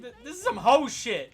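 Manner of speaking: loud, slow, fed-up dad blurting it out; a dad finally losing patience, loud and slow; exasperated outburst. Retold or imitated. Dad reacting to his daughter's crush news, loud exasperated. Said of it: "He's like".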